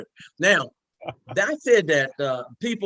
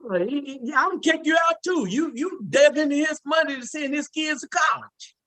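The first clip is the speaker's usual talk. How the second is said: excited laughing outburst; 11 semitones higher than usual. Real, not acted. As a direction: blurt it out, cracking up at the doctor. Host cracks up at story.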